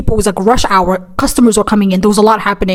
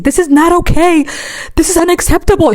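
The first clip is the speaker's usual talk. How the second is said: higher indignant lecturing; mock speech; 7 semitones higher than usual. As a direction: preach it like a protest speech. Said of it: "she's giving a speech".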